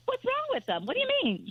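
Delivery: high, startled disbelief; baffled. Retold or imitated. Owner hearing her bagels insulted, high shocked squeak. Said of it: "And I thought".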